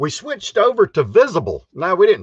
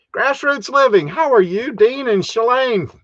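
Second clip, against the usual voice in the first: excited greeting; 9 semitones higher than usual. Real, not acted.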